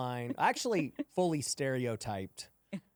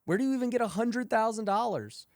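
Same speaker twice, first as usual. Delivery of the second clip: raised, louder pleading questions; exasperated asking; 9 semitones higher, 4 dB louder than usual. Retold or imitated. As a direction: throw the questions up in frustration. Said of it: "And it's like".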